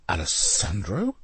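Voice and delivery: barely believe it; shaken. A narrator's reading. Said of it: "said a shaken Leonardo".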